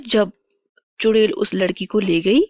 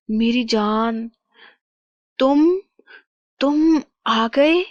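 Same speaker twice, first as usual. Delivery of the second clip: slow, halting words, rising higher; weak, astonished reunion; 4 semitones higher, slower than usual. Acted. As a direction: stammer in slow disbelief at seeing your beloved. Reunion of king and captive queen. (धीमी, अटकती, हैरान आवाज़)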